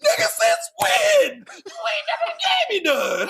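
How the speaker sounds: hammed-up wounded wail; mock wail. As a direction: complain like a betrayed friend. Mock outrage at friends.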